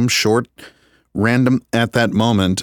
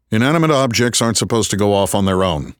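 ordinary delivery, nothing marked

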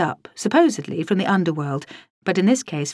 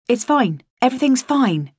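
hasty false reassurance; 6 semitones higher, 4 dB louder than usual. A narrator's reading. Markham hastily reassuring her.

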